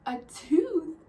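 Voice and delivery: high, loud startled squeal; shocked child. Retold or imitated. Child shocked at lost tooth.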